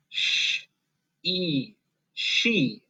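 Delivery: soft hushing "Sh", soft long "e", then "she"; sounding out. Shown as a demonstration. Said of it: "and say".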